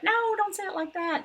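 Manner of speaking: high, sharp correction, hammed up; mock scolding. Parent scolding a child's pronunciation, mock sharp. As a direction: play a scolding parent, a bit over the top. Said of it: "you don't have to say, like".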